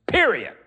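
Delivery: period hit high and loud; emphatic period. Real, not acted. Preacher ending a verse with emphasis, loud and high. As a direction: slam the word period down hard. Emphatic close of the scripture quote.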